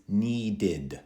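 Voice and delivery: NEEDED in two syllables, ending in a clear id; extra id ending. Shown as a demonstration. Teacher modelling the past tense of need, extra id ending. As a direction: say NEEDED slowly with an id at the end. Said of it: "I pronounce the E D differently".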